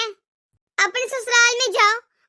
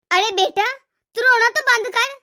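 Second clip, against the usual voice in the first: normal talking voice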